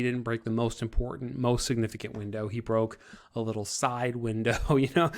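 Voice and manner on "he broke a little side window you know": usual speaking voice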